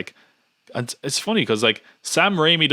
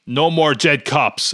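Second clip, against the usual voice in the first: mock crowd extra; 5 semitones higher, 5 dB louder, much slower than usual. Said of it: "one guy's like".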